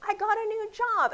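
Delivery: high, bright and loud announcement; mock excited post. Facebook user announcing a new job, hammed-up excitement. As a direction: squeal the news like a proud poster. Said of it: "someone will post, like".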